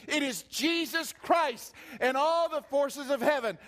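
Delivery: loud, high, urgent preaching; fervent exhortation. Acted. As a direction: press it on the hearers. Exhorting the congregation.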